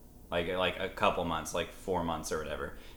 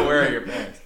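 scolding adult; 4 semitones higher, 7 dB louder, faster than usual. Pretend.

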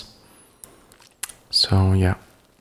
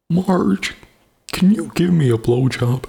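Homer Simpson voice; 8 semitones higher than usual. Pretend. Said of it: "it's not working".

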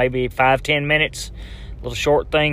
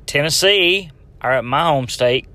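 ordinary delivery

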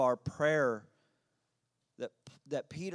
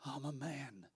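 Isaiah whispering his weakness before God; broken, fragile plea; 8 dB quieter than usual. A narrator's reading. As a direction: quietly confess your weakness, nearly breaking. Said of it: "so weak and so broken".

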